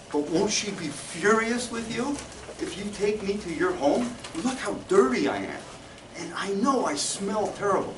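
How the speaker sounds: the beggar fretting that the wife will be furious; worried homeless man. Acted.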